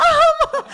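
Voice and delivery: very high, laughing, stunned um; mock stunned hesitation; awkward um. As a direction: stall in disbelief. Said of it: "I'm like".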